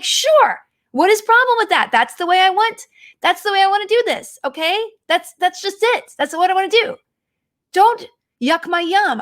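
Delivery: mock defiant. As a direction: play it as a sassy brush-off of the friend. Defends her fancy cabin, mock defiant.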